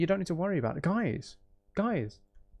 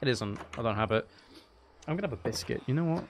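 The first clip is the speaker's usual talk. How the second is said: normal talking voice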